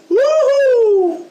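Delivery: high, loud, drawn-out cheer; excited whoop. Real, not acted. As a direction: let out a gleeful whoop. Cheering during game action.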